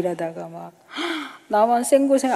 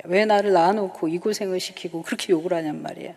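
usual speaking voice

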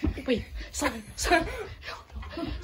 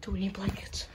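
hushed whisper; 9 semitones lower, 9 dB quieter than usual. Real, not acted. Hiding from the owner, whispering to friends. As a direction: keep it down.

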